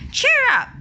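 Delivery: high, loud cheer; calling out. Acted. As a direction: call cheerfully down the hole. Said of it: "they called out".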